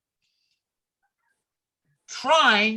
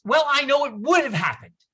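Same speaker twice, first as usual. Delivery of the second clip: mock confident editor; 5 semitones higher, 5 dB louder, faster than usual. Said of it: "Then the famous".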